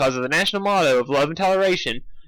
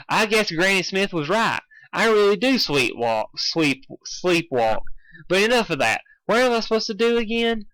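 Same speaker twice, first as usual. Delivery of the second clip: lightly voiced Applejack; 7 semitones higher than usual. Applejack, sleepwalker, speaking.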